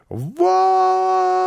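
very high, drawn-out shriek; wild scream. A narrator's reading. Housekeeper throwing Karlsson out, wild scream. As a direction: shriek it and hold the note. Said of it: "с диким воплем; закричала она".

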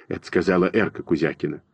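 ordinary delivery

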